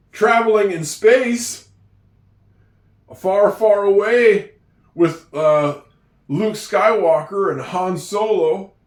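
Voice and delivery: playful storytelling. Acted. Playful Star Wars example.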